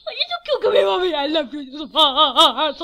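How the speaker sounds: loud, over-the-top adoring wail; mock crying. Gushing at an adored dog, hammed-up loud crying. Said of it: "cry at him and to, like".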